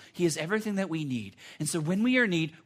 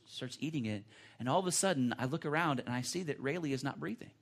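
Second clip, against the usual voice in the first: ordinary talk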